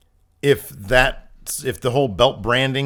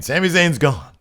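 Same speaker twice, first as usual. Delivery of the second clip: Mick Foley impression; 7 semitones higher, 9 dB louder than usual. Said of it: "and he was saying".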